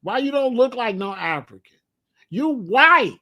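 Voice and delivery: mock kid calling out the newcomer. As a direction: laugh him out of the room as a kid. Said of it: "These kids is gonna be like".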